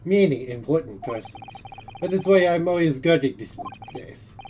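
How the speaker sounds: put-on Russian accent. Plays Russian sailor.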